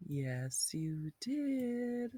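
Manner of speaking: a playful stage whisper to the class; breathy teacher whisper. Acted. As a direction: whisper it like a little secret.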